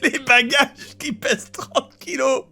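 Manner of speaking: laughing through the words; barely getting words out; crying with laughter. Real, not acted.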